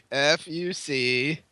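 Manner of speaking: mock slow spelling, letters drawn out. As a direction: drag out F U C very slowly. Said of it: "he went slower".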